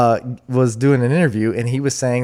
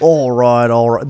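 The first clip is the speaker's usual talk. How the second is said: cockney echo; 7 dB louder than usual. Pretend.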